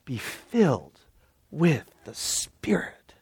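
dropping to a whisper; hushed. Acted. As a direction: breathe it out softly, slow. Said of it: "I love this".

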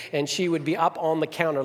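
ordinary talk

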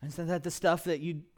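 ordinary talk, nothing marked

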